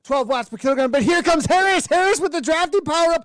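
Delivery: loud, high sprint commentary; excited race call. Real, not acted. Commentator calling the final sprint, loud excited shout. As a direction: shout the finish as it unfolds.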